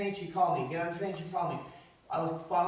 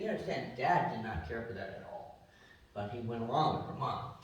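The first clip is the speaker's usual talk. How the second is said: normal talking voice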